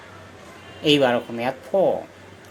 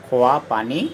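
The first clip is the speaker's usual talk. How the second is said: slow dictation. Shown as a demonstration. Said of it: "বাক্য কেনেকৈ বনাম".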